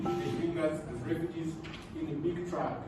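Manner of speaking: normal talking voice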